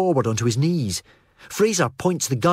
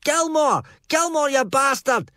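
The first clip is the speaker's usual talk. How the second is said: furious yell. Acted. Drunk Fraser yells at Stuart across the sand, much higher and furious. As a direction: roar the name across the beach. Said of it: "Fraser yells".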